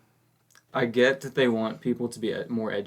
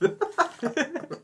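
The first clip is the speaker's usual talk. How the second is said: high, real giggle escaping; burst of laughter. Real, not acted. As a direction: laugh that breaks loose. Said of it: "I was trying not to laugh".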